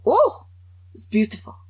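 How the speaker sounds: high, loud "oh" of delight; admiring French listeners. Acted. French people hearing her speak, mock admiration. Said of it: "every time the French hear it, they go".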